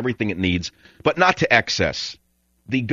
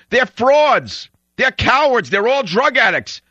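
much higher, louder shouting; retold yell; 12 semitones higher, 4 dB louder, slower than usual. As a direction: yell it across the store to the clerk. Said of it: "I yelled out in the loudest voice".

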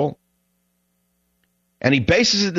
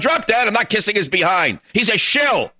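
normal talking voice

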